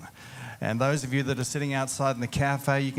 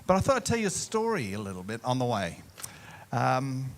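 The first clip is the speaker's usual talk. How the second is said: normal talking voice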